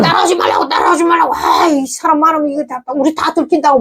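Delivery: high-pitched, pleading warning not to follow; retold frantic "stop following us"; urgent shooing off. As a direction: push them away in a high, urgent voice. Quoting companions telling her off.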